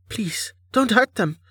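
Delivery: high, timid captive's plea; anxious high-pitched begging; frightened pleading. Acted. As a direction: beg in a small high voice. Captive pleads with god for friends.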